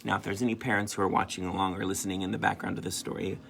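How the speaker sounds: ordinary delivery, nothing marked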